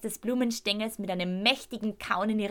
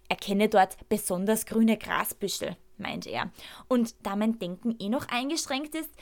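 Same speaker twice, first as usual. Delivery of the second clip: plain reading voice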